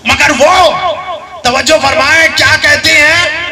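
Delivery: loud, high impassioned sermon; fiery preaching. Acted. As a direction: build it up like a pulpit climax. Preacher raises voice.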